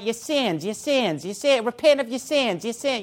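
a hint of a New Zealand accent, nagging; mock New Zealand accent. Mocking a rival preacher's nagging about sins, mild New Zealand accent. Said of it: "with his little New Zealand accent".

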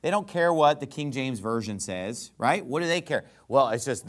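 ordinary talk

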